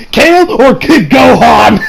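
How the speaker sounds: loud, slow, delighted call-out; excited shout. Real, not acted. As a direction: yell it like a fan hyped for the matchup. Excited question about dream fight.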